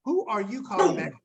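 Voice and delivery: ordinary talk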